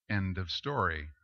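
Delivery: normal talking voice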